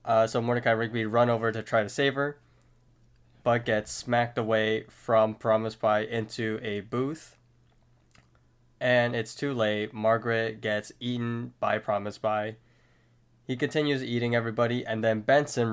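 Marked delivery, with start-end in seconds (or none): none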